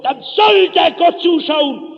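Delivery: loud, high, slow defiance; trotzig. Acted. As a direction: throw it out as a stubborn challenge. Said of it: "Da geht Trotz in ihm hoch". (laut und trotzig)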